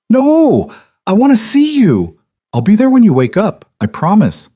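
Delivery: put-on very high, insistent child's protest; pleading little girl. Daughter begs her dad to see him tonight, high and pleading. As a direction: beg like a small kid who won't sleep.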